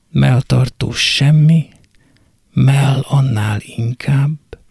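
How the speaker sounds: plain reading voice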